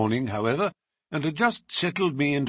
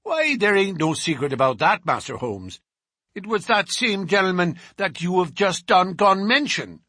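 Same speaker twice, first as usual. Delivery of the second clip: higher, louder, fast fawning goodbye; smooth it over nervously; cowed thug trying to make peace; ingratiating bruiser; 7 semitones higher, 3 dB louder than usual. A narrator's reading. Bruiser gives up his master.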